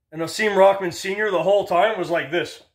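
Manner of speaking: ordinary talk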